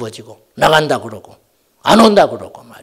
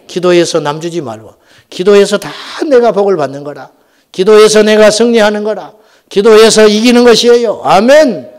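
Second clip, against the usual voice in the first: rallying exhortation, building louder and higher; forceful preaching; 5 semitones higher, 7 dB louder than usual. Acted. Pastor urging the congregation to win through prayer, rising preaching. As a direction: build to a ringing amen.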